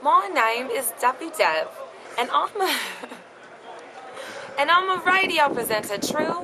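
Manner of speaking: English accent slipping into American; attempted English accent. Shown as a demonstration. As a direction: try the accent and crack up. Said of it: "you did the American at the end".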